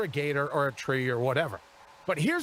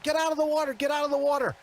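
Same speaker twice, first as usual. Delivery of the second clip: retold scream; 9 semitones higher, faster than usual. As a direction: shout the warning from the bank. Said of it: "they're screaming".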